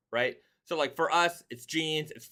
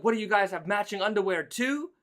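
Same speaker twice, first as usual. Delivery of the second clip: higher-pitched, mildly mock yell; retold yell; 6 semitones higher than usual. Storyteller imitating a lady on the metro, mock yelling. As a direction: imitate a stranger calling out a nosy question. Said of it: "And then she yelled out".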